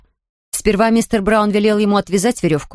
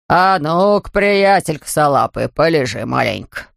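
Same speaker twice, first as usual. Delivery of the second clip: lightly lowered, folksy voice; easygoing stationmaster; 4 semitones lower than usual. A narrator's reading. Stationmaster lays bear back down, easygoing low voice. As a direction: settle him down, dropping low at the end.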